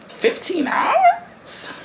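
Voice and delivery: high drawn-out disbelief; incredulous. Real, not acted. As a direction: cry it out in shock. Disbelief at download time.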